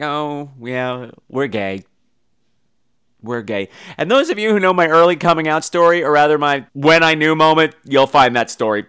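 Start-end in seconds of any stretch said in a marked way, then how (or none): 0.0-3.7 low, soft deadpan; understated, low-voiced admission; deadpan aside. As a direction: drop it low and quiet, matter-of-fact. Deadpan aside after shrieking.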